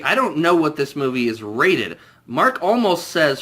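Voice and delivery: ordinary talk, nothing marked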